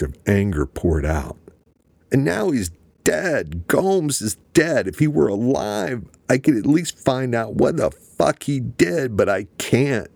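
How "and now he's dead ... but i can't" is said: high, anguished cry, pitch way up; screaming. A narrator's reading. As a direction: rage spilling out over a dying brother. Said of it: "he screamed".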